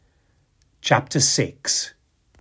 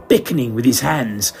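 ordinary delivery, nothing marked

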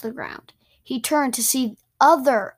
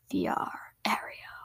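whispered spell; 11 dB quieter than usual. Acted. Casting a spell.

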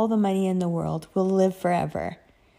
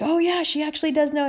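high, quick mock realization; imagined reader chiming in, higher and faster; impressed reader; 9 semitones higher, faster than usual. Readers reacting to her book knowledge, mock impressed.

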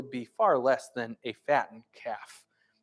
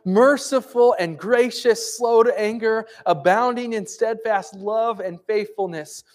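scripture proclamation; 6 semitones higher, 5 dB louder than usual. Acted. Pastor proclaiming psalms to the congregation, preaching.

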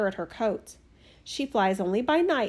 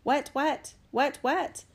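higher, croak-like frog call; tree frog's warning; 4 semitones higher, slower than usual. A narrator's reading. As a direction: cry a repeated warning as a frog. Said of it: "a tree frog sitting in the brushwood cried a warning".

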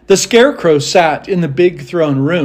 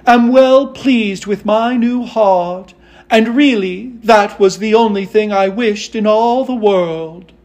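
higher-pitched, earnest Tin Woodman; well-pleased Tin Woodman; 5 semitones higher, 3 dB louder than usual. Acted. Tin Woodman pleased with his new heart, high and earnest. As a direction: speak up high with measured contentment.

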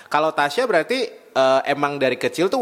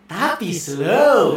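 drawn out slow; 3 semitones higher, much slower than usual. Shown as a demonstration. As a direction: stretch the word slow out long. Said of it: "Kita talk".

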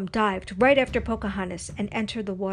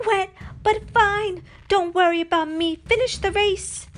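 yelling back; 11 semitones higher, 6 dB louder than usual. A narrator's reading. Nakoma urging Pocahontas to finish, yelling. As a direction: holler it back over the water. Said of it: "Nakoma yelled back".

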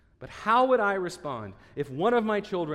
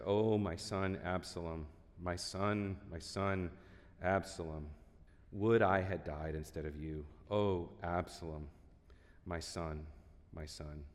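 soft, grieving father's cry, much quieter; grieving lament; 11 semitones lower, 10 dB quieter than usual. A narrator's reading. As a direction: let the grief pull the voice down low and quiet. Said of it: "went up to the chamber over the gate and wept".

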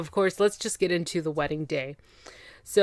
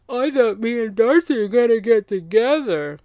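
higher, louder mocking quote; mock whining ex; 4 semitones higher, 6 dB louder than usual. Ex insisting he'd get back together, mock whining. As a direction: put on the ex's indignant voice. Mocking upset ex's hopeful claim.

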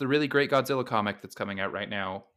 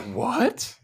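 high, stunned what; shocked; 12 semitones higher than usual. Retold or imitated. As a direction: gasp it out in disbelief. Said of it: "And you're just like".

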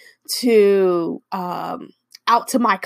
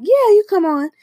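excited invite; 11 semitones higher, 6 dB louder than usual. Retold or imitated. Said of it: "they'd be like".

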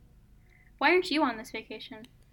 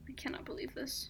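mock despair whisper; 3 semitones higher, 10 dB quieter than usual. Real, not acted. Mock despair over photos.

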